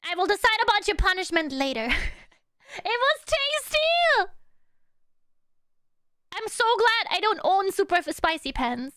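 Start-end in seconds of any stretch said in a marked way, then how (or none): 2.7-4.3 high, slow mock confession; playful chat voice. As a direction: answer as the guilty chat. Voicing chat eating the pen.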